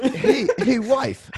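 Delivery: mock greeting. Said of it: "that's how I acknowledge her".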